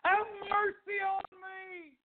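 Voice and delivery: high drawn-out beggar's cry; long, high-pitched plea. Retold or imitated. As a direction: call out slowly and high, pleading for mercy. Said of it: "He cried".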